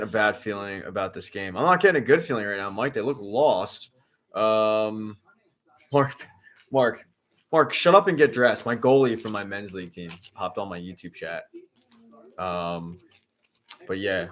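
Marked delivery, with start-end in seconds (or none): 5.9-8.7 louder, higher joking order to a teammate; mock-stern running-gag command; mock telling-off. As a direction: playfully order a friend about, voice raised. Running gag at goalie in chat.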